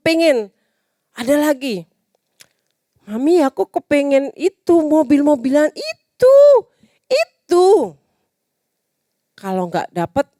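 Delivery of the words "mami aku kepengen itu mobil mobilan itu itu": put-on high begging child's voice, rising; whiny child. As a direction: plead like a small kid wanting a toy. Child asking mother for toy. (anak kecil merengek minta mainan ke mami)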